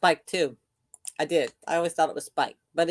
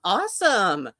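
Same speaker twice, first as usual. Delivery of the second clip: normal talking voice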